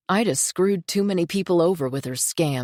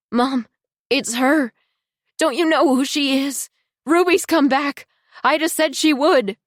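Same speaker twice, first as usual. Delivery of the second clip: frantic; 9 semitones higher, 6 dB louder than usual. Acted. Frantic daughter recognises Ruby.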